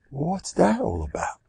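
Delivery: high, soft puzzled question; mock bafflement. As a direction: play the innocent, taken aback. Older sailor baffled by the threat.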